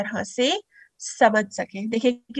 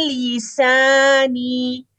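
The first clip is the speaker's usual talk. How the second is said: drawn-out चanted लिसानी; slow recitation; much slower than usual. Shown as a demonstration. Teacher reciting the word for students.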